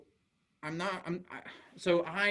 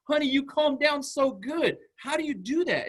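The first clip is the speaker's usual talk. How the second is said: gushing praise; 11 semitones higher, 5 dB louder than usual. Retold or imitated. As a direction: marvel warmly at a little kid. Amazed praise to calm a child.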